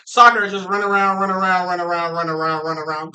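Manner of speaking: loud, droning run of commentary; mock soccer commentary. Mocking soccer's endless running, parody commentator. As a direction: chant it in one loud breathless string.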